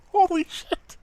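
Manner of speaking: real high-pitched shock; shocked disbelief. Real, not acted. Stunned reaction to the ad.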